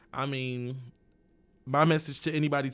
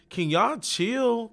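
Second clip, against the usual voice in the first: high and slow, pleading them to chill out; exasperated plea; 10 semitones higher, 5 dB louder, much slower than usual. Retold or imitated. Telling hostile people to chill, high exasperated plea. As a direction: stretch it out, begging everyone to relax.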